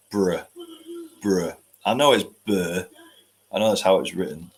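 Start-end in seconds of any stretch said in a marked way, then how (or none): none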